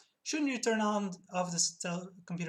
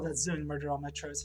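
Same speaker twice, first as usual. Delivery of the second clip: normal talking voice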